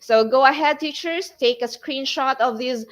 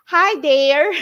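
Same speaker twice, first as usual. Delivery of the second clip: mock teacher greeting; 4 semitones higher, 6 dB louder than usual. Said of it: "some teachers would say".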